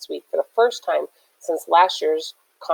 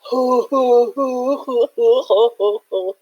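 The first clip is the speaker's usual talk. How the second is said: drawn-out jeering laugh; long mocking laugh; 6 semitones higher, 4 dB louder than usual. A narrator's reading. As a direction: laugh them off the hill. Rivals laugh at them.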